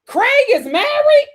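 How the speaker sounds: mock stunned "married?"; shocked coworker. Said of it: "they're like".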